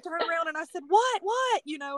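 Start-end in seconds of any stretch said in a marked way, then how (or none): 0.9-1.6 high-pitched mock alarm; startled double-take, acted out; startled question. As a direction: blurt it out, much higher, as retold surprise. Retelling startled question to husband.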